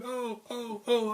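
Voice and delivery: small high cries, as if taking hits; imitated pained ohs. Boxer taking shots in the retelling, high mock yelps.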